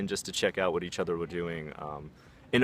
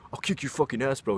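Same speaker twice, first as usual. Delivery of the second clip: imitating a challenger, raised pitch; put-on tough-guy threat, pitched up; mock threat; 6 semitones higher than usual. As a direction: threaten like a cocky bro, higher voice. Imitated challenger threatening big friend.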